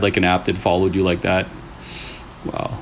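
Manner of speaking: ordinary delivery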